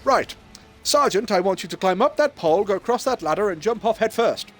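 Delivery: British general ordering the sergeant; officer giving orders. Acted. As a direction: order him about briskly. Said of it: "he goes".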